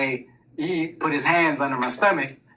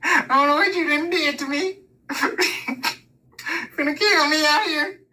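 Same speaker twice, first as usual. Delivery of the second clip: high, laughing protest; laughing as he speaks; laughing complaint; 13 semitones higher, slower than usual. Real, not acted. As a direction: talk through the giggles. Complaining to dad while laughing.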